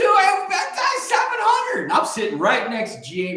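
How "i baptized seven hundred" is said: much higher, a little louder, drawn-out mock brag; excited boast. Young preacher boasting at dinner about baptisms, excited. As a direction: crow it like a kid bursting with pride. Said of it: "I'm excited too".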